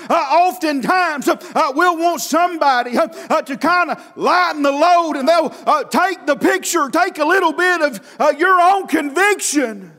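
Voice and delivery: rhythmic revival preaching with gasped "uh"s; full-voiced sermon cadence; cadenced preaching. Real, not acted. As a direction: drive each phrase out in a preacher's chant. Preacher back into driving cadence.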